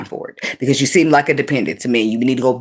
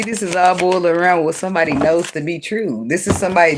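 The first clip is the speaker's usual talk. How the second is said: normal talking voice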